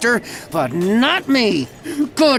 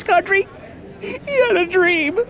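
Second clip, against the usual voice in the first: voice cracking; 10 semitones higher than usual. Acted. Choked-up patriotic dream speech.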